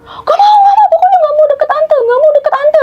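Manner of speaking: put-on high, loud whiny child's crying wail; imitating child crying. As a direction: mimic a toddler sobbing and refusing. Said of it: "dia nangis kejar". (tiruan anak kecil menangis merengek, suara tinggi dan keras)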